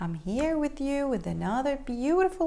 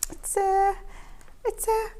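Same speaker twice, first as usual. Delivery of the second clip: high, hesitant cat voice; flustered little cat; 7 semitones higher than usual. A narrator's reading. Keith fumbling an excuse in front of cats, flustered. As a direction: stumble to find the words.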